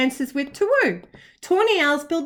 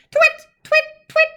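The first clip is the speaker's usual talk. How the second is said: high, louder "twit, twit, twit"; owl call; 4 dB louder than usual. A narrator's reading. Olive calling again for a partner, high owl call. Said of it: "called Olive, trying again".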